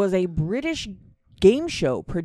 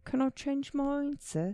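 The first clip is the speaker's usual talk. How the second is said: put-on asking again in a British accent, softer; a hammed-up British accent; mock British accent; 7 semitones higher, 7 dB quieter than usual. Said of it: "you have to say it in a British accent".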